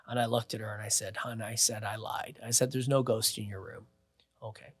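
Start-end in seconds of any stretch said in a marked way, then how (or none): 1.2-4.7 put-on low, hushed talk to a child; retold gentle aside, much softer and lower; soft reassurance. Entertainer consoling the scared kid, mock-soothing and hushed.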